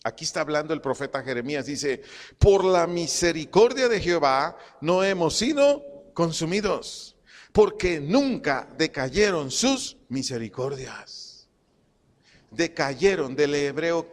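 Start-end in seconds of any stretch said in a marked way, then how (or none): 2.4-11.5 slow, emphatic scripture reading; reading aloud. Real, not acted. Preacher reading Jeremiah with the congregation, reading aloud. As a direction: read the verse out for all to follow. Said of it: "léalo conmigo en voz alta".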